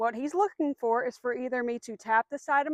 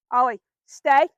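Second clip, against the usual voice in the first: loud, firm stay command; a real firm command to the dog; 10 dB louder than usual. Real, not acted. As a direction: hold the dog in place with the voice. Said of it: "in a pretty firm voice".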